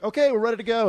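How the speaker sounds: high, bright put-on greeting; chirpy star voice. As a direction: play the fake-friendly celebrity. Acting out press junket star.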